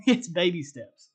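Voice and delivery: laughing. Real, not acted. Laughing at his own analogy.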